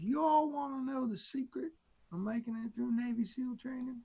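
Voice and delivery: lightly put-on Southern accent of a drill instructor; Southern instructor accent, loosely imitated. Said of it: "talking in a deep Southern accent".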